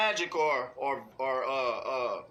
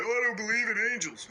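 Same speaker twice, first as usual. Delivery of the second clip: higher, fast sneering mimic of a doubter; mock the nonbeliever, a bit faster; higher, faster mock skeptic voice; 6 semitones higher, faster than usual. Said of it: "'Cause I know some of y'all like".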